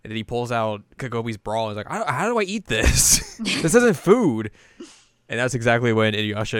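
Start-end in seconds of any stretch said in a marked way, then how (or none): 1.9-4.5 high-pitched, slowed-down confusion; hammed-up bewildered complaint; mock bafflement. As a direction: stretch it out in puzzled protest. Acts boy baffled by bra as food.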